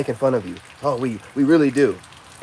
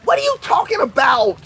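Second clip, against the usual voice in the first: mocking women; 8 semitones higher than usual. Mocking women's online talk.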